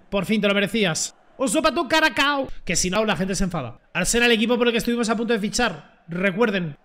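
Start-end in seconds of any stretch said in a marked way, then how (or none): 1.4-2.5 mocking taunt. Real, not acted. Mocking taunt after goal.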